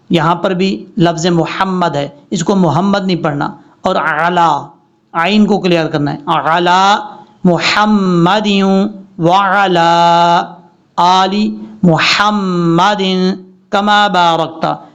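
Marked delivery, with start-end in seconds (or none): 6.2-14.9 slow, clear ain in अला and light ह in मुहम्मदिन; correct reading. Shown as a demonstration. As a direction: read each word slowly and cleanly. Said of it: "इस तरीके से।". (साफ़ और धीरे तजवीद)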